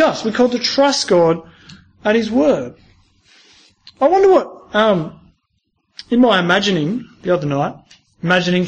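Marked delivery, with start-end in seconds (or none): none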